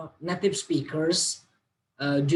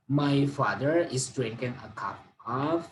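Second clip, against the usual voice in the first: usual speaking voice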